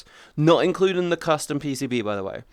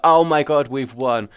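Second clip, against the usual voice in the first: gloating; 5 dB louder than usual. Retold or imitated. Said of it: "laughing at everyone ... and go".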